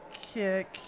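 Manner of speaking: ordinary talk, nothing marked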